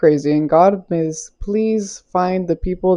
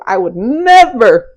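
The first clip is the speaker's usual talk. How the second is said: "never" drawn out, higher and louder; emphatic, long, raised "never"; stress on never; 5 semitones higher, 4 dB louder, slower than usual. Real, not acted. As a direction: lean hard on "never", stretching it. Emphatic vow to the viewers.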